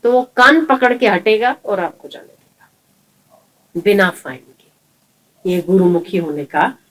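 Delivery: normal talking voice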